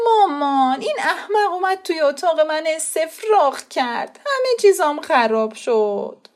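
high, whiny tattling to mother; disgusted complaint. A narrator's reading. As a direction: tell on him with disgust. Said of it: "خسرو با انزجار گفت". (شکایت با انزجار به مادر)

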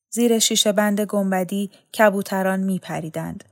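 plain reading voice

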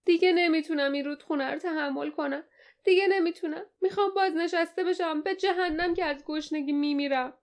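high-pitched, fed-up whine; moaning complaint. A narrator's reading. Deckhand tells his captain he wants to retire, moaning in a high voice. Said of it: "ناله کرد".

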